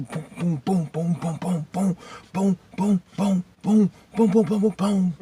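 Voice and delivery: rising volley of mock impact sounds; imitated punches. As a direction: rattle off cartoon punch noises. Imitates the blows of a beating.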